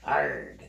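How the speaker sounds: short voiceless pirate growl; mock pirate grunt; pirate "arr". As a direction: throw in a playful pirate noise. Shows off a pirate's cap.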